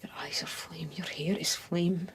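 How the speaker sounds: very soft, intimate near-whisper; hushed seduction. Acted. Lover imagining kisses with the beloved, hushed and intimate.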